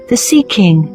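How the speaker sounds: plain reading voice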